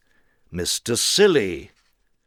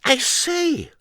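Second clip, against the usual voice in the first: very high squeaky Mister Nonsense; high silly voice; 3 dB louder than usual. Acted. Narrator voicing Mister Nonsense, high and squeaky. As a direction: pipe it up like a daft little man.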